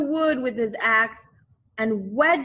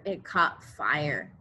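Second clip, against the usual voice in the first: ordinary delivery, nothing marked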